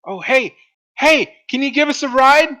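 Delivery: high, loud calling out; excited high shout for a ride; yelling. Acted. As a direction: yell to catch a passing caravan. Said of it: "Adira yells".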